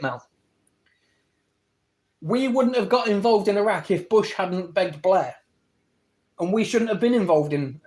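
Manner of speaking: ordinary talk, nothing marked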